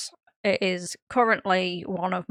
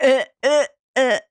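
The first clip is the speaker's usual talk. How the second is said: higher, loud "uh" grunts of disgust; imitated cringing noises; 5 semitones higher, 6 dB louder than usual. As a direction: act out someone jittering and cringing.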